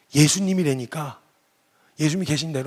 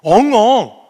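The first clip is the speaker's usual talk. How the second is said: mock sobbing; 6 semitones higher, 12 dB louder, much slower than usual. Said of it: "빵 터져서 우시는 거예요".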